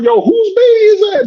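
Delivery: acted high-pitched, incredulous call; alarmed calling out. Bystanders looking around at a lone toddler, alarmed calling out. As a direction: ask the whole street in mock alarm.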